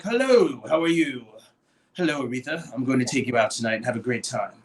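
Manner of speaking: a hint of a smooth, proper charmer; sophisticated upper-class voice. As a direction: mimic Marlon's polished suitor. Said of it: "the voice you heard".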